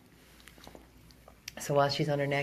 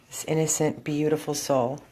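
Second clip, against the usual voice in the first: ordinary talk, nothing marked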